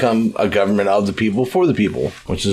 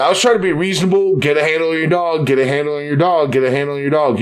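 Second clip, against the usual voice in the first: raised, fast, fed-up telling-off; exasperated scolding; 6 semitones higher, faster than usual. Retold or imitated. Annoyed character tells owner to control dog, raised and fast.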